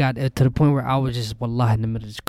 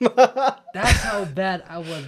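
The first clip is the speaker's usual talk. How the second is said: laughing; 7 semitones higher, much slower than usual. Real, not acted. Laughing at his own story.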